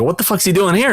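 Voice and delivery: retold disbelief, voice lifting; baffled. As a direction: ask it as if he makes no sense being there. Retelling his baffled reaction.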